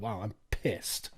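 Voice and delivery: muttered fake anger; deadpan mock outrage. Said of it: "I'd be like".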